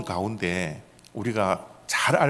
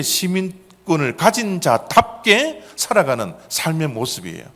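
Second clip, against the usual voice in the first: higher, louder sermon delivery; raised preacher's voice, pressing the key points; emphatic preaching; 5 semitones higher, 4 dB louder than usual. Acted. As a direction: drive each phrase home to the congregation. Preaches with rising force.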